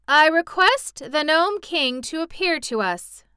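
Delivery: high, loud, formal summons; calling out. A narrator's reading. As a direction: project a royal request across a distance. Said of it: "Ozma called again".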